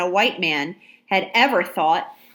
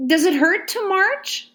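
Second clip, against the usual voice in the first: put-on much higher little girl's voice, concerned; young girl; 11 semitones higher than usual. A narrator's reading. Ladysmith asks the soldier about marching, girl voice. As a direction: ask gently like a child.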